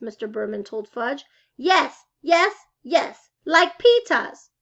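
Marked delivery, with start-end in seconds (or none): 1.6-4.5 put-on loud, slow child's holler; a toddler demanding his way. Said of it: "Fudge hollered".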